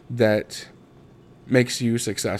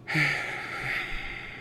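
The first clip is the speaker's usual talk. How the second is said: long, soft breathy sigh; heavy sigh; 5 semitones higher, 8 dB quieter than usual. Real, not acted. Speaker pausing over a painful memory, heavy sigh. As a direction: let out a long real sigh.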